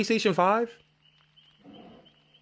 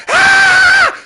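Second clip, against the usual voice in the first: huge high screaming crowd roar; 12 dB louder than usual. Retold or imitated. Arena crowd erupting as Stone Cold's music hits, full scream. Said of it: "it was like".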